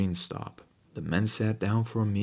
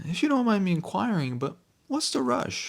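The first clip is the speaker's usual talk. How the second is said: timid servant; 11 semitones higher than usual. Acted. Servant questions masters.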